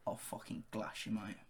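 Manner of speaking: whispered Cockney threat; London accent. Shown as a demonstration. Co-host threatening to glass him, whispered London accent. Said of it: "Give us your best London accent".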